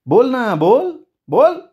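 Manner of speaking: high-pitched insistent demand. A narrator's reading. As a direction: press for an answer, pitch climbing sharply. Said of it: "मृणालचा आवाज चढला होता".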